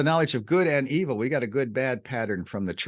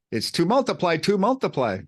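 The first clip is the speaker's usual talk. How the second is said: to multiply said twice, both times raised and stressed; repeated emphatic multiply; 6 semitones higher than usual. Shown as a demonstration. Teacher demonstrating a Hebrew stutter, emphatic. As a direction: lean into the doubled verb multiply. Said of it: "It's got this stutter".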